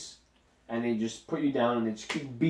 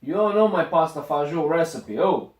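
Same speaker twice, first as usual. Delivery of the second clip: lightly voiced Italian-American accent, louder; a hint of a proud Queens Italian uncle; 4 semitones higher, 5 dB louder than usual. Bragging about grandfather's recipe, lightly voiced Italian-American accent. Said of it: "We're back at [name]'s accents".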